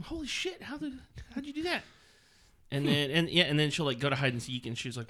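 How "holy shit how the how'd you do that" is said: high amazed exclamation; stunned by the toddler. Retold or imitated. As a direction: parent astonished at the kid counting. Surprised by counting kid.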